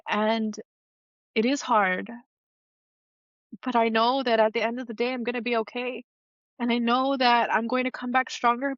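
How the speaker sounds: real, mildly choked-up confession; getting emotional. Real, not acted. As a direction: admit the struggle, emotion creeping into the voice. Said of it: "I'm sorry if I'm getting emotional".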